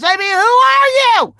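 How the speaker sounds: loud, high comic rant; rising outrage, played for laughs. Real, not acted. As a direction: rant it up at the chat. Ranting about being exposed.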